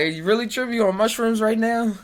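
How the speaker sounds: high, loud disbelief; incredulous question. Retold or imitated. To a friend acting strange, loud incredulous teasing. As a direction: ask it like they've lost their mind. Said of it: "I was like".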